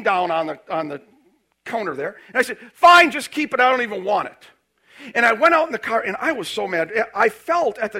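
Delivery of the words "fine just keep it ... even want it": loud, high retold rant at the clerk; loud, high, furious customer losing it; angry outburst. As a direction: blow up at the shop lady, really loud. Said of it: "I went berserk on her".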